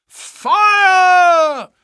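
high, ringing all-out yell of fire; loudest fire shout. Acted. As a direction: shout so it carries over the whole town. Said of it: "The shout must have rung over Norwood".

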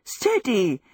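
alarmed cry falling away; gasped warning. A narrator's reading. Sophie, holding a knife, is danced about, gasped warning. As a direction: gasp it out while being swung about. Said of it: "she gasped".

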